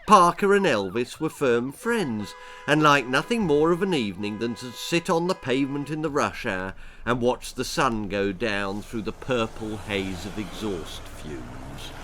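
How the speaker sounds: plain reading voice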